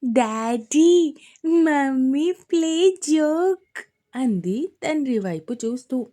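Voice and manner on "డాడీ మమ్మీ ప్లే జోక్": put-on slow, high toddler voice with a giggle; giggly little girl. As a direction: tease like a small girl. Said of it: "ముసిముసిగా నవ్వుకుంటూ".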